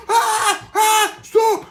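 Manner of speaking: very high, loud mock scream of pleading; mock screaming woman. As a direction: shriek it like she's begging in panic. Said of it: "you should have heard her screaming".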